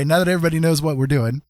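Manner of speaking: ordinary talk, nothing marked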